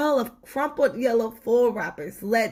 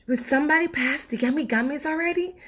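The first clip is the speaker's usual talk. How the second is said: higher, fast, greedy demand; impatient sweet tooth; 7 semitones higher, much faster than usual. Acted. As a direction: rush them for the gummies. Tooth demands gummies in cinema.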